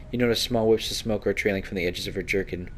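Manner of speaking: usual speaking voice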